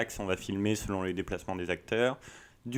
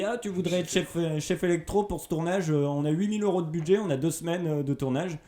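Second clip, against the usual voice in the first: eager producer voice; 8 semitones higher, 4 dB louder than usual. Retold or imitated. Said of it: "on m'a dit".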